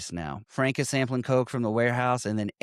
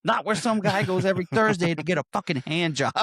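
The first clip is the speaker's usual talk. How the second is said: scolding boss; 7 semitones higher than usual. Retold or imitated. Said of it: "Frank says".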